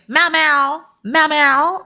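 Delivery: sing-song the nickname up high, mocking him; high mock pet-name call. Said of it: "And he was like".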